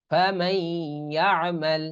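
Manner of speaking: ফামান ইয়ামাল said famayy-ya'mal, no n; silent nun. Shown as a demonstration. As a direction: hold the nasal y joining ফামান to ইয়ামাল. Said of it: "নুনের উচ্চারণ হবে না".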